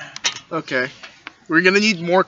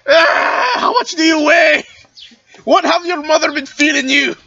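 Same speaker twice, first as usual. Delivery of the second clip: loud, high strained cry while lifting; straining; 8 semitones higher, 4 dB louder, slower than usual. Acted. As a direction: groan out the words under a heavy load. Straining to lift stone daughter.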